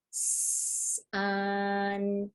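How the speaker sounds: drawn-out S, then a long level vowel; really slow. Shown as a demonstration. Teacher modelling the word before blending it, really slow. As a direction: hold each sound before moving on. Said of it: "elongating those sounds".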